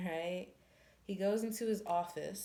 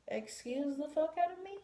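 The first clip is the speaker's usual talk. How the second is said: high, indignant squawk; outraged disbelief; 9 semitones higher than usual. Retold or imitated. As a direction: act offended and shocked. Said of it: "And she's like".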